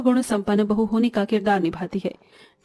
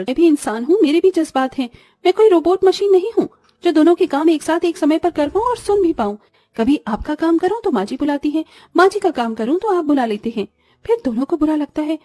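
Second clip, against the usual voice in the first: high-pitched, exasperated protest; fed-up outburst; 6 semitones higher than usual. Acted. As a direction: pour out your frustration at both of them. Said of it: "बर्दाश्त से बाहर हो जाती है". (तंग आकर ऊँचे सुर में फूट पड़ना)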